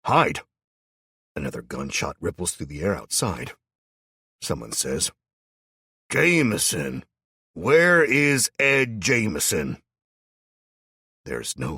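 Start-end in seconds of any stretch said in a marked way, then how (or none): 0.0-0.4 loud, falling command; urgent order. A narrator's reading. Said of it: "he orders us". 6.1-9.8 loud, high, slow calling shout; shouting outside. A narrator's reading.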